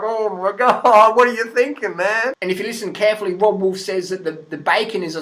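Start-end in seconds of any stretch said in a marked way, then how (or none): 0.0-2.3 exasperated disbelief. Retold or imitated. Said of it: "And I'm just like".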